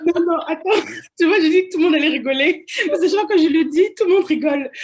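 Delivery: loud, high, laughing protest; amused protest. Real, not acted. Protests amid everyone's laughter. (protestation amusée, voix forte et aiguë)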